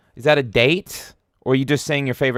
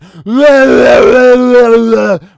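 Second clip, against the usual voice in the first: high, loud, drawn-out wild babble; loud imitated gibberish noise; 12 semitones higher, 13 dB louder than usual. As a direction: imitate frantic chaos with a long yell. Imitating yesterday's frantic stream.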